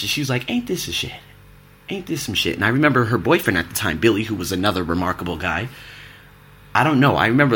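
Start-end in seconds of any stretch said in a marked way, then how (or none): 0.5-2.6 much higher, lightly put-on exasperated mom; mock mom voice. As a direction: scoff it like his fed-up mother.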